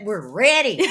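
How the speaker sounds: mock Southern "ready"; imitated Southern twang. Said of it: "you just said".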